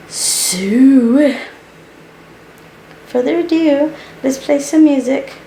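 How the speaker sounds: high, bright DJ-style announcement; playful announcer. Acted. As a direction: announce the music like a host. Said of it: "announcing music playfully".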